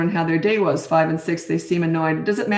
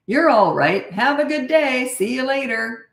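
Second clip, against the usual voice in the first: upbeat and breezy, pitched higher, unhurried; no big deal, cheerful send-off; breezy goodbye; 6 semitones higher, slower than usual. Shown as a demonstration. Parent dropping off a crying five-year-old, breezy and calm. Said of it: "Like that".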